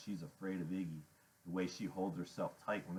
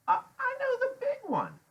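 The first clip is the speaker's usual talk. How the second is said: very high, loud woman character; Loretta's voice; 7 dB louder than usual. A narrator's reading. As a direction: squawk it high as you puzzle out a face.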